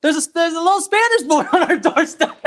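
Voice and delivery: high mock holler breaking into laughter; excited calling, laughing. Older gentleman telling his wife about the boy, laughing imitation. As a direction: imitate an excited shout while cracking up. Acted older man, teller cracking up.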